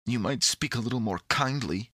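usual speaking voice

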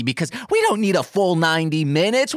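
ordinary talk

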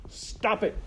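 high, sharp outburst; shouted. A narrator's reading. Rat interrupting princess and maid, shouted. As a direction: break up the moment with a yell. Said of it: "shouted Rescue".

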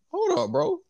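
very high-pitched mock bafflement; retold disbelief. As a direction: squeak out an incredulous reaction. Retold bafflement at rapper's new accent.

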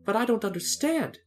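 high-pitched puzzlement; baffled young heroine; bewildered. A narrator's reading. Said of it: "said Dorothy in bewilderment".

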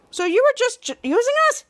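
high, rising disbelief; shocked question. Acted. Captain Man realizing Deuce used them, shocked high question. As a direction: squeak out the betrayal.

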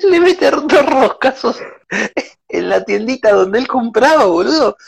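high-pitched laughing through words; wheezing with laughter; laughing hard. Real, not acted. Cracking up retelling cockroach scene.

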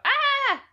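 short, high, loud scream; startled-sounding high shriek; sudden scream. Real, not acted. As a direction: yelp out a sudden "ah!". Sudden outburst while finishing up.

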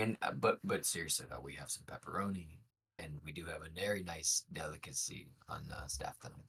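deadpan serious man trailing off; low hushed sincere aside; straight man. Shown as a demonstration. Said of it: "the joke man and also the straight man".

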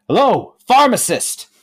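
high, loud call to a closed door; high, loud hail. Acted. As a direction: shout for the shopkeeper. Calling at closed shop.

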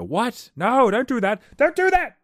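high, loud yell at a video; yelling at the screen. Retold or imitated. Viewer yelling at an animal video, loud and high. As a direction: shout a warning at the screen. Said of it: "where you yell at the screen and go".

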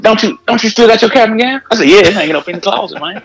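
high, light voice for the friend asking; mock friend voice. Acted exchange over cap and gown.

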